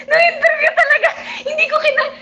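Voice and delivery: high laughing shriek. Real, not acted. Vlogger cracking up over the interview, laughing shriek. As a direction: break up laughing.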